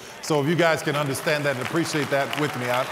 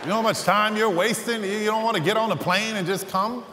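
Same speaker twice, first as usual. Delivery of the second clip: agent's voice pitched higher, pushing; exasperated agent; 5 semitones higher than usual. Retold or imitated. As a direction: push back like a baffled agent. Agent arguing about the bus.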